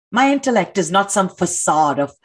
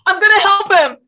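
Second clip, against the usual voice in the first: loud, high, determined cry; defiant shout; 9 semitones higher, 5 dB louder than usual. Acted. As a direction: shout it while pushing past. Protester pushes past cop.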